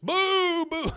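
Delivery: loud, high boos; mock booing. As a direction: boo her hard. Crowd boos Peggy.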